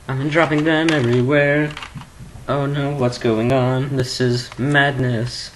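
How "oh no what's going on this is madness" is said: ordinary talk, nothing marked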